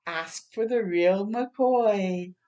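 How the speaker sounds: slow, punchy slogan; slow emphatic ad line; slogan delivery. Acted. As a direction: sell it like an ad tagline.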